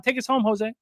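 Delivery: ordinary talk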